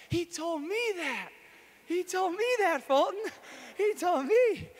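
high, loud, thrilled cry of joy; overjoyed exclaiming. Retold or imitated. Telling Jesus gave her Fulton's words, overjoyed. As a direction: burst out with delight. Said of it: "I was just so happy".

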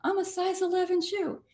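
breathy high society lady; Jackie Kennedy. Retold or imitated. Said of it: "And she said".

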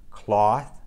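"Cloth" ending on a clear th with the tongue between the teeth; "Cloth" with the final th held and blown out; exaggerated th practice. Shown as a demonstration. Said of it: "more minimal pairs to practice the T H sound".

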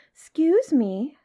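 high, slow, drawn-out polite little elephant; most polite. A narrator's reading. Elephant's child approaches the crocodile, most politely. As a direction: call out a very polite, high "excuse me". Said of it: "said the elephant's child most politely".

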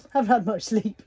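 ordinary delivery

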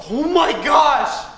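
high, loud, slow exclamation; shocked. Real, not acted. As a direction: gasp it out in shock. Sudden fright.

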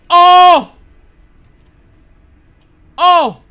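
high, very loud cry of "oh"; sudden real yelp of fright; startled yell. Real, not acted. Player jolted by a horror game, startled yell.

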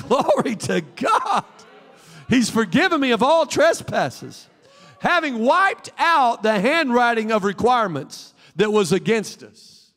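slow, fervent preacher cry; full preaching. Real, not acted. Pastor preaching forgiveness to the church, fervent and slow.

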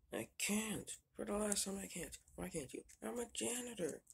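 high, worked-up protest; exasperated refusal. Acted. Janitor tells someone pleading about prices he can't, exasperated.

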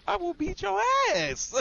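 high, laughing, drawn out; laughing threat. Real, not acted. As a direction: laugh while you threaten. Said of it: "how many times do I have to say it".

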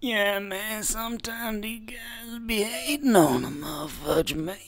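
high, smooth put-on gangster voice, laid back; lightly hammed-up smooth G persona; put-on cool gangster. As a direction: strut through it like a smooth player.

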